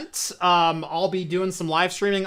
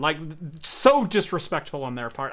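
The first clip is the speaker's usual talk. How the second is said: ordinary delivery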